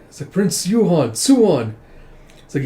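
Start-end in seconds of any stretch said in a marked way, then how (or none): 0.3-1.7 exclaimed greeting. Acted. Greets prince and found child.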